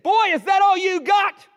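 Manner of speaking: put-on high, loud, furious shout of "Boy, is that all you got?"; angry taunt. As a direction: bellow the challenge at the smaller boy. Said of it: "now he was mad".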